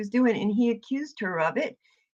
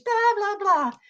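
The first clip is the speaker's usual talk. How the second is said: high, sing-song mocking; mocking blah blah; 12 semitones higher, slower than usual. Streamer mocking Jody Sue's complaints, high sing-song. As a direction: dismiss her words with a sneer.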